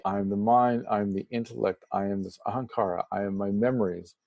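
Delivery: normal talking voice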